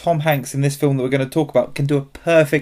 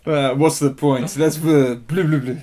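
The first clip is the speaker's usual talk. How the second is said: put-on French accent. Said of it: "we managed to offend Eastern Europeans and the French".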